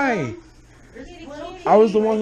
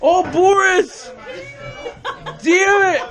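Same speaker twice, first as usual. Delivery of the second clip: excited calling; 11 semitones higher, slower than usual. Real, not acted. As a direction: call the cat, thrilled.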